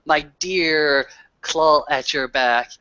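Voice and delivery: usual speaking voice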